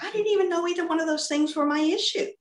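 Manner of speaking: fast, high-pitched retold protest; taken aback. Retold surprised reply to God.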